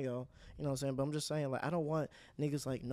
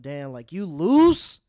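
mock drawn-out shout; 14 dB louder, much slower than usual.

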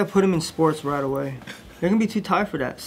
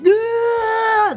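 high wailing cry on yeah, much higher; mock baby wail. Dad imitating his son crying all day, high mock wail.

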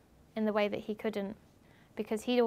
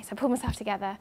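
ordinary delivery

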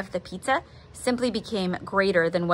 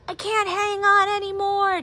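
high, strained yell; father crying out as he loses grip; 12 semitones higher than usual. A narrator's reading. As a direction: yell it while slipping into the vortex. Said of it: "Dad yelled".

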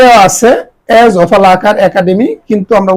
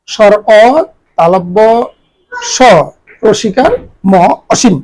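ordinary talk, nothing marked

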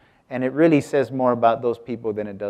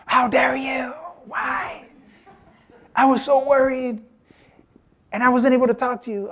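high, breathy, hissed scolding from an imitated furious wife; whisper-shout like a wife who waited up worried; wife scolding her late husband in a high hushed fury; mock livid wife; 13 semitones higher than usual. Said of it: "my wife was livid".

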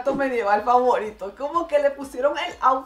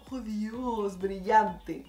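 ordinary talk, nothing marked

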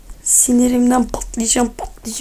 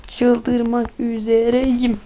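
very slow, stretched syllable by syllable; slow-motion frustration; drawn-out exasperation; much slower than usual. Real, not acted. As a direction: drag out every word, about to lose it.

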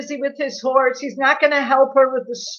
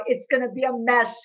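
normal talking voice

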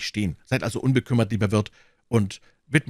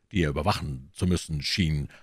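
usual speaking voice

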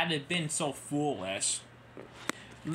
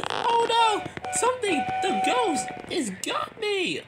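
very high, loud cry of alarm; panicked cry; 3 dB louder than usual. Acted. Nia thinks the ghost has caught her, panicked. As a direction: shriek it like a ghost grabbed you.

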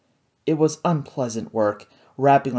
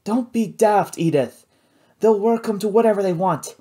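put-on higher, no-nonsense old lady; lightly voiced scolding old woman; brisk old sister; 7 semitones higher, 3 dB louder than usual. A narrator's reading. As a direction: tut at her sister.

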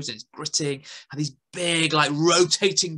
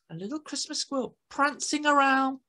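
plain reading voice

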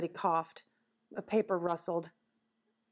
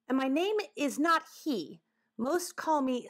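higher-pitched, self-important animal character; talking woodchuck, huffy; 7 semitones higher than usual. Acted. Woodchuck introducing himself to the children, huffy. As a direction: correct them with huffy dignity.

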